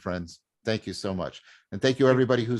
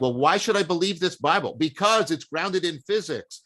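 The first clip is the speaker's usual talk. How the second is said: higher-pitched mock questioner; imitated challenge; 6 semitones higher than usual. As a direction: voice an imagined sceptic's challenge and its answer, pitched up. Voicing a sceptic's question and answer.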